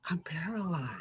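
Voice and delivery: unvoiced whispered confession. [name] alone, whispering that he is paralyzed. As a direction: whisper it, frozen in place.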